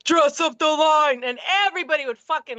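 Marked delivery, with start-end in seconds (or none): 0.0-1.2 slow, drawn-out police command voice; put-on mannish cop bellow, stretched out. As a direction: call out the order like a drill cop. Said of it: "that's my cop voice".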